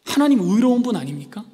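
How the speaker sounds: loud, high, slowing down; the prophet's outraged challenge, loud and slow; indignant question. Acted. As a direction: demand an answer from God. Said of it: "라고 물어보는 거예요".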